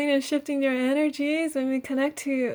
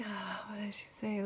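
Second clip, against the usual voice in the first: whisper; 2 semitones lower, 10 dB quieter than usual. Real, not acted. Aside while listening to the goddess.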